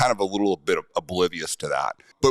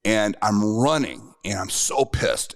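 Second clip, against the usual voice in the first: ordinary talk, nothing marked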